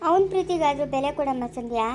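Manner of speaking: ordinary talk, nothing marked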